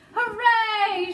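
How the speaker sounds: high, loud, stretched cheer; shouted cheer. A narrator's reading. Grasshopper cheering the captured seagull, jubilant shout. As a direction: celebrate with a big cry. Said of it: "shouted the old green grasshopper".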